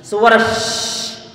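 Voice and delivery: slow hissed shh spreading air; long steady shh hiss; drawn-out shin. Shown as a demonstration. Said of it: "Ini adalah".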